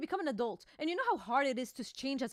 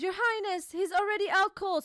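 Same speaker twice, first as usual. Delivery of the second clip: lightly voiced underling, higher and a bit louder; a servant reporting to his king, pitched up; mock-urgent report in a higher voice; subordinate's voice; 7 semitones higher, 5 dB louder than usual. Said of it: "And somebody goes".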